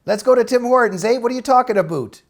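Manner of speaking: put-on Canadian accent with a teasing "eh". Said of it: "I loved hearing your Canadian accent".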